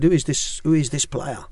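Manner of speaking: a quick, playful Israeli accent impression; Al Berkovic's Israeli accent. Ex-coach retelling a footballer's question in a mild Israeli accent. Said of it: "Trying to do an Israeli accent".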